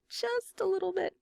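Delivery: playful high-pitched repeat of the whisper; squeaky echo. Pretend. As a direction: pipe it up high like a cartoon mouse. Echoes the whispered aside.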